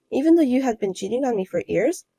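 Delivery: ordinary delivery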